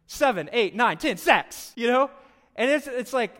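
mock exasperated crescendo; building comic count.

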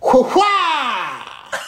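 high laughing whoop; showman whoop. Retold or imitated. Throwing his shirt to the crowd with a high whoop. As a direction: whoop like a rock star. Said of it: "I was like".

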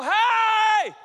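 long, high, loud holler; protesting shout. Acted. Believers protest to God from Egypt, loud holler.

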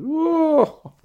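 high, drawn-out whoop of amazement; exaggerated wow at grand promises; amazed whoa. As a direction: let out a big high-pitched whoa. Mock amazement at husband's promises.

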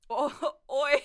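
uncertain stab at Cockney; attempted Cockney accent. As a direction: try out a Cockney "oi". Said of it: "I don't know how to do a Cockney accent".

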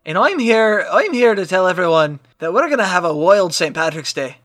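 put-on Irish accent. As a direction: fake Irish announcer. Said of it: "With that accent".

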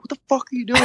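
high-pitched mock yell; retold yelling. Locked-out guy pounding on his friend's door, yelling. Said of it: "I'm literally yelling".

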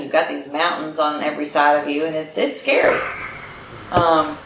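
ordinary talk

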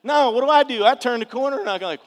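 normal talking voice

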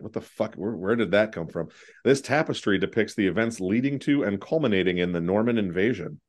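usual speaking voice

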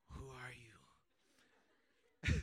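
very low, hushed retold question; crazy-eyed awe. Stunned guard asking the student who he is, hushed. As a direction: barely voice it, stunned and wary. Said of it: "looking at me all crazy-eyed like".

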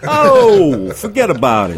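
put-on big New York accent, high and loud; a loud New York "forget about it". Teasing the host in a hammed-up New York accent. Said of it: "the New York accent is disappearing".

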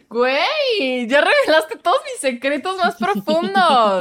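mock outrage. Real, not acted. Friend teasing her on the podcast, mock outraged protest. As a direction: protest like your secrets were exposed.

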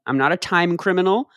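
usual speaking voice

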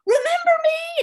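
high, loud eager shout; calling out. Retold or imitated. Exam seatmate hailing her across the street, calling out. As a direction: holler it over to a half-forgotten acquaintance.

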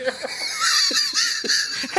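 very high-pitched burst of laughter; real laughing fit. Real, not acted. Storyteller laughing at being recognized by the lady, high cackle. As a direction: crack up at your own story. Cracking up at the lady's line.

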